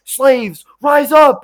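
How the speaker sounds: loud, high battle cry; rallying shout. Acted. Abe calling slaves to revolt, in a rallying shout. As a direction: shout it out to rouse a crowd.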